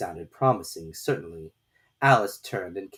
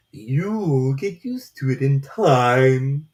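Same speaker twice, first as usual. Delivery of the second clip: very slow, loud, strange drawn-out voice; slow caterpillar drawl; 4 semitones higher, 7 dB louder, much slower than usual. A narrator's reading. Caterpillar lazily questioning Alice, very slow and loud. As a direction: stretch every word lazily, from on high.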